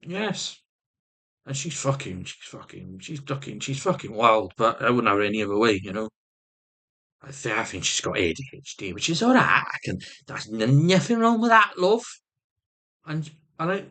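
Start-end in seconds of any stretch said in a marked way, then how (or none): none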